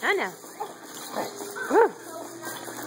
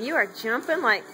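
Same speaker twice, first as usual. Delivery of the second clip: usual speaking voice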